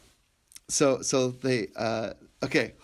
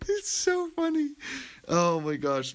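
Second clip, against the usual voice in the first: laughing; 12 semitones higher, 3 dB louder, slower than usual. Real, not acted. Laughing fit.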